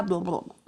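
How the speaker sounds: low, softened jumble of blah-blahs; tongue-tied babble. Shown as a demonstration. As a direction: imitate words getting tangled in the mouth. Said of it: "na hora de falar ... embola".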